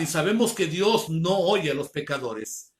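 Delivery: ordinary delivery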